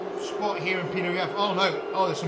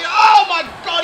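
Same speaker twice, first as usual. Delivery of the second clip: real shout of shock, pitched high; commentator's stunned outcry; shocked yell; 9 semitones higher, 10 dB louder than usual. Real, not acted. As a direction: burst out in disbelief.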